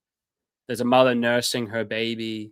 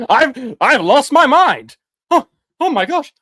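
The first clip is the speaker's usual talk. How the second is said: high, loud sarcastic exclamation; mock outrage; 11 semitones higher, 11 dB louder than usual. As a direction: ham up being accused of madness.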